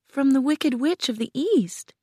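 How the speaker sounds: put-on high little girl voice; meek Dorothy. A narrator's reading. Dorothy answers the wizard, high and girlish.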